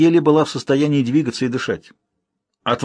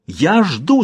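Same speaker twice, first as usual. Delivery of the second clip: крик; 6 semitones higher than usual. Acted. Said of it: "закричала княгиня".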